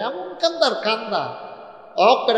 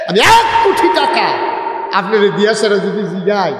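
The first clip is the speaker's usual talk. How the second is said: emphatic call; 3 semitones higher, 6 dB louder than usual. Real, not acted. Preacher emphasizes to audience.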